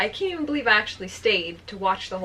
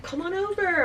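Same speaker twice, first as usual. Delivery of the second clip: retold invitation; 8 semitones higher than usual. Boyfriend invites her over by phone.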